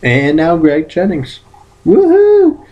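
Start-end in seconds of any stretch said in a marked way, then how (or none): 1.8-2.6 high, loud joyful whoop; happy whoop. Real, not acted. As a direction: whoop with delight. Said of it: "I'm happy".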